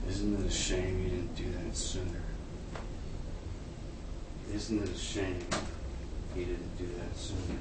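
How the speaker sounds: soft, low muttered aside; under his breath. Retold or imitated. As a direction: say it quietly to yourself. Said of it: "say under his breath".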